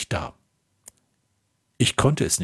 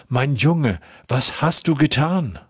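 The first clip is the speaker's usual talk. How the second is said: narrated motherly outcry, higher pitched; mother's cry; 5 semitones higher than usual. A narrator's reading. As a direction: cry out to a son in a raised, restrained voice. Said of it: "rief sie".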